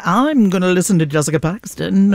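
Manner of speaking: put-on a weak stab at an Australian accent; lightly put-on Australian accent, mostly own voice; Australian accent, barely there; bad Australian accent. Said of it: "that was a really bad Australian accent".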